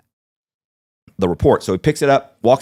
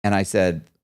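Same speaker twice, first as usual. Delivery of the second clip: normal talking voice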